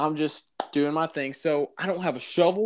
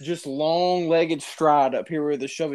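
ordinary talk

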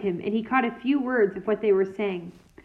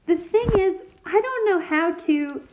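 higher, flustered restart; nervous; 7 semitones higher than usual. A narrator's reading. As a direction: stumble again, anxious and higher. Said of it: "she said kindly".

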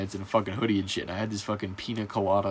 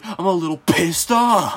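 slow, higher, louder teacher scold; mock angry teacher; 7 semitones higher, 7 dB louder than usual. Teacher scolding students in the car, mock angry. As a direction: imitate the teacher holding back anger.

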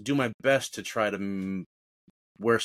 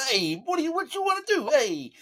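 high, squeezed voice with head tucked down; chin-tucked voice. Shown as a demonstration. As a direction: press the Adam's apple up and squeak it out. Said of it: "I like to put my head like".

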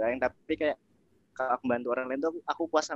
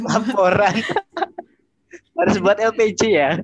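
loud and laughing, much higher, teasing; laughing tease; 8 semitones higher, 13 dB louder than usual. Real, not acted. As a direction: burst out laughing while joking about a report. Teasing joke about needing report. (menggoda sambil tertawa keras)

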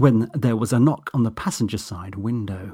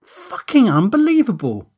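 higher, louder, disbelieving scorn; incredulous contempt; 10 semitones higher, 7 dB louder than usual. A narrator's reading. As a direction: spit it out in disbelief. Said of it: "incredulous dagger with barely disguised contempt".

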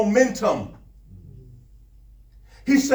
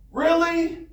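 high, drawn-out disbelief; mock-exasperated, pitched up high; incredulous; 7 semitones higher than usual. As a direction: question it as if utterly put upon. Acted Moses in disbelief.

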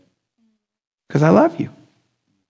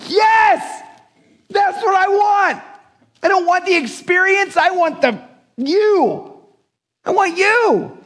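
high, yearning plea from an honest heart; earnest longing; slower than usual. Pretend. Preacher voicing Moses's heartfelt request, high and earnest. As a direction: ask for God himself with open longing. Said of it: "From a heart that's like".

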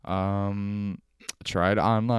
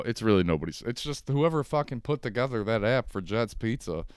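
ordinary talk

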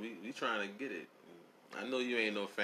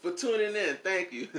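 high, laughing backwards phrasing; laughing Yoda line; 10 semitones higher, 9 dB louder than usual. Pretend. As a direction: giggle through the Yoda words. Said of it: "How would he say it?".

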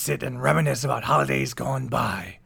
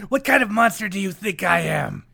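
ordinary delivery

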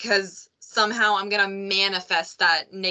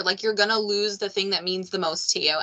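ordinary talk

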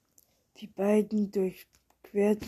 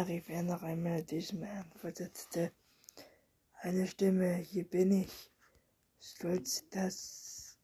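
hushed storyteller's whisper throughout; whispered story reading; 3 dB quieter than usual. A narrator's reading. Reading a bedtime story aloud, whispered. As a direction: read the tale under your breath.

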